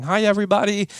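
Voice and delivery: high, chirpy mock kid hello; kid's greeting. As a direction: pop in high like an excited kid on the podcast. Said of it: "Levi running onto the podcast going".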